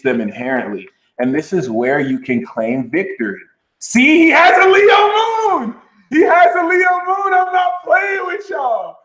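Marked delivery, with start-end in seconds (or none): 3.8-9.0 high, loud, stretched-out exclamation; excited shout. Real, not acted. To her viewers, a high thrilled shout about the discovery.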